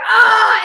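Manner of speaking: sudden high yelp; shocked yelp. Retold or imitated. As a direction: blurt out a startled cry. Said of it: "and I was like".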